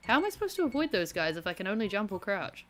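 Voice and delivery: ordinary talk, nothing marked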